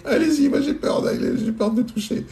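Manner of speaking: put-on high, breathy old granny voice; frightened granny pointing to the bedroom; old lady voice. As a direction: play the scared little granny.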